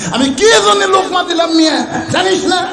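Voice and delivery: high, shouted comeback; indignant retort. Acted. Shepherd answering the man who corrected him, indignant shout. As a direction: pitch it way up and demand an answer. Shepherd snaps back in acted quarrel.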